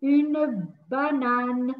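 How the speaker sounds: "Une banane" stretched syllable by syllable; very slow model. Shown as a demonstration. Teacher drawing out "Une banane" for young learners. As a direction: drag out "Une banane" for children. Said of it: "teacher models slowly".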